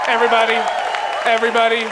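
loud rising call to the crowd. Real, not acted. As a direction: shout it out, coaxing the room. Comic prods a quiet crowd.

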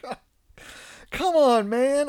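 high, loud mock groan of disbelief; laughing exasperated come on man. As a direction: throw your hands up with your voice. Reacting to son's remark.

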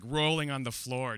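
usual speaking voice

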